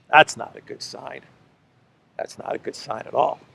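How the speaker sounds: hushed "That's not a good sign at all"; whispered ominous aside. Real, not acted. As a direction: drop to a grim whisper. Ominous repeated comment.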